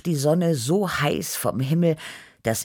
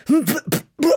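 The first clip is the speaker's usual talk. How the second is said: high, sputtering lip noises; repeated spitty "b" bursts; spitting out sand; 13 semitones higher, 4 dB louder than usual. A narrator's reading. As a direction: splutter as if the mouth is full of sand. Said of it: "spuckte ich nach einem Sandsturm".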